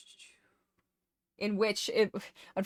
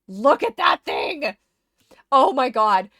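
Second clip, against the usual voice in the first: much louder, slow, thrilled; excited exclaim; 4 semitones higher, 14 dB louder, slower than usual. Real, not acted. Streamer shows off the rose she finally got, thrilled. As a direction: show it off with delight.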